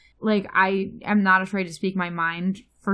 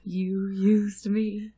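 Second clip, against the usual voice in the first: loud, slow put-on cartoon voice; cartoon character voice; 10 dB louder, slower than usual. Playing a SpongeBob character accusing her partner, loud and slow. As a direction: ham up an aggrieved cartoon character.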